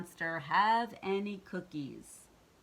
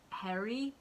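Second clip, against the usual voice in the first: usual speaking voice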